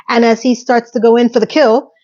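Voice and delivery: ordinary delivery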